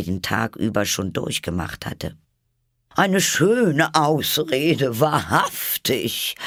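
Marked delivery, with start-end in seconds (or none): 3.0-6.3 slow, high, louder scornful pigeon; contemptuous high bird voice; deep contempt. A narrator's reading. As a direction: sneer every word. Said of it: "im Tone tiefster Verachtung".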